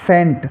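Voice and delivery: "सेंट" opening on a plain S, C unpronounced; silent C. Shown as a demonstration. Teacher giving scent as an example, "सेंट" with silent C. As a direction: say "सेंट" with no K sound after S. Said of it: "सी silent रहेगा".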